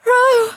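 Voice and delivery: high, loud wailed name; shrill wail. A narrator's reading. Dulcinea wails to her cavalier from Gideon's arms, shrill wail. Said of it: "Dulcinea wailed out ... weak and shrill".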